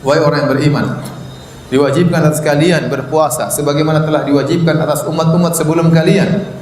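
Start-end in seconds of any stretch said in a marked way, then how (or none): none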